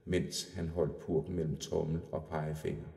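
usual speaking voice

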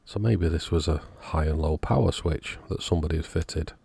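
ordinary delivery

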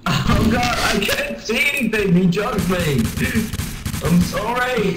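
high-pitched flustered apology; alarmed. Real, not acted. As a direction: cry out that you are in trouble. Drugged, blinded player apologising in alarm.

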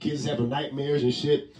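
ordinary talk, nothing marked

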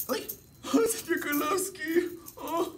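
squeaky falsetto puppet greeting; high puppet voice. Acted.

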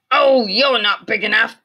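loud high scoffing; grouchy ladybug voice. A narrator's reading. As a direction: scoff it loudly. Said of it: "said the grouchy ladybug".

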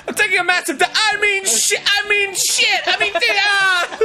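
very high, flustered yelling; comic desperate self-correction, shouted; super frantic. Acted. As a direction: stumble over the excuse in mounting panic. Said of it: "Super frantic".

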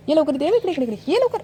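high, urgent call to a little one. Real, not acted. Calling her small child over.

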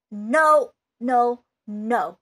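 loud, firm repeated no; emphatic no. Real, not acted. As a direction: shut the idea down hard. Rejecting bad investing advice.

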